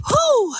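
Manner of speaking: relieved exhale. Real, not acted. Calming herself down on stream.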